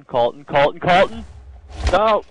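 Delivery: repeated name building to a high, loud yell; urgent calling out. Real, not acted. Gamer warning friend about a creeper, urgent calling out. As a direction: call your friend with rising alarm, ending in a yelled no.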